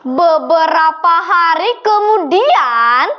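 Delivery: usual speaking voice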